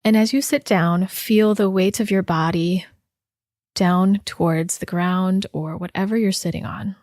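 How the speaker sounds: unhurried body-scan guidance with long pauses; guided meditation. Real, not acted. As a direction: guide listeners gently inward, leaving space after each instruction.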